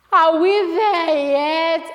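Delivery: loud, high, drawn-out complaint; slow, loud mock-weary wail; tired whine. Tired walker complains on journey.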